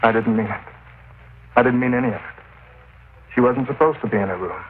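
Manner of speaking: high, strained, distraught voice; broken confession. Acted. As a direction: crack as he confesses. Killer breaks down, confesses.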